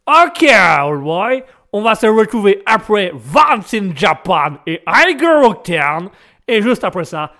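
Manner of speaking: loud, drawn-out Texan cowboy accent; hammed-up Texan accent radio intro. Shown as a demonstration. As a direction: boom it out like a Texas cowboy with an American accent. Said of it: "il y a l'accent américain aussi, texan".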